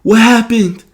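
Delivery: high, loud mom demanding an explanation; alarmed mom. Retold or imitated. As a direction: imitate a mother calling her son to account. Mom confronts guilty son.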